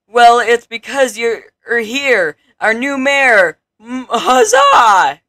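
theatrical game advisor, celebrating; exuberant town advisor. Acted. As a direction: hail the new mayor, building to a drawn-out cheer. Voices the game's advisor.

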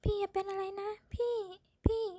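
high, keening lament; woman wailing over the man she shot; wailing grief. A narrator's reading. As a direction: cry over his body. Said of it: "เสียงผู้หญิงร้องไห้อย่างคร่ำครวญ".

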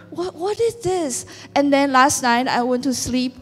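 ordinary delivery

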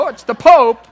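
high, loud mock sneer; mock gossip. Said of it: "You know, all that stuff".

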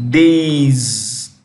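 "Days" as one long syllable; pronunciation demo. Shown as a demonstration. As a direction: stretch the ay in "Days". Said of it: "How do you pronounce it?".

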